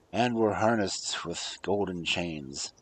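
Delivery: usual speaking voice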